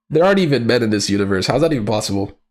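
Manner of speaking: ordinary talk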